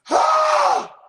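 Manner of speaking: high, loud scream of shock; mock terrified shriek; startled shriek. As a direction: shriek like you just got threatened. Shocked at death threat.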